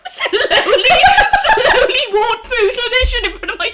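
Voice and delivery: very high, loud, squealing through laughter; helpless laughing. Real, not acted. Telling co-host the funeral director punchline, helpless laughing. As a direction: crack up completely while getting the words out. Hysterical at her own punchline.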